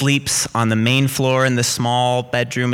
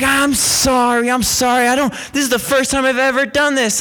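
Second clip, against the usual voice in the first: retold sheepish apology; 10 semitones higher than usual. Intruder questioned over a granola bar, high retold apology. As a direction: imitate a pleading excuse, pitched up.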